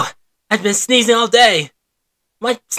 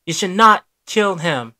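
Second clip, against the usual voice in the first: ordinary talk, nothing marked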